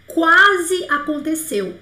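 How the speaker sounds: slow, drawn-out question. Shown as a demonstration. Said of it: "stretching the question slowly".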